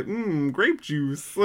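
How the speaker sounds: slow, drawn-out savoring. Retold or imitated. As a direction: savor each word slowly. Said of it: "funny to hear them say".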